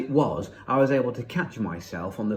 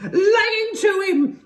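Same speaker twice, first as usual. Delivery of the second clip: drawn-out, high, loud shriek; 7 dB louder than usual. A narrator's reading. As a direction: egg him on in wild excitement. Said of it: "she was shrieking".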